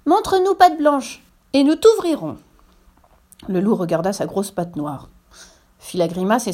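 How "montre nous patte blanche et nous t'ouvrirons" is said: put-on loud high-pitched child's challenge; very loud cry. A narrator's reading. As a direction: cry it out high through a door. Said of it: "cria très fort".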